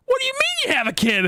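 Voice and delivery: very high, squeaky disbelieving cry; shocked disbelief. Retold or imitated. Retelling his shock at her having a kid, squeaky disbelief. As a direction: squeal it like the news just broke your brain. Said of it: "I'm like".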